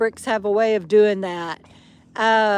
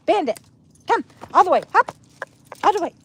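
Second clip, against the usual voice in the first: higher coaxing call; calling the dog; 6 semitones higher, much faster than usual. Real, not acted. Owner calling Bandit to jump into her lap. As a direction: invite him up with a lift.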